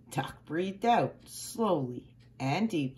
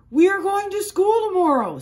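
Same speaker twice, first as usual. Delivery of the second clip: high, loud excited announcement; excited farmer announcing; 12 semitones higher, 9 dB louder than usual. A narrator's reading. Farmer telling the cows, high and loud with excitement. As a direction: call it out high and bright with excitement. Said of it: "said Farmer Brown".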